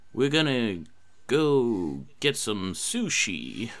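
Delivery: slow drawled Texan drawl, lightly done; a lazy Texan drawl trailing to a whisper; Matthew McConaughey impression. Lightly done Texan drawl playing Matthew McConaughey for the game. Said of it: "let me see Matthew McConaughey here".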